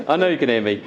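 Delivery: projecting across the room. Real, not acted. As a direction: push the voice out to the back. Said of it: "for being loud".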